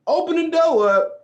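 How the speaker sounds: building alarm. Pretend. Imagining alligator at door.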